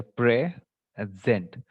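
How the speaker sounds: "pray" and "zent" as two separate beats; split into syllables. Shown as a demonstration. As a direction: break the word in two, "pray", pause, "zent". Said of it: "two syllable words are there".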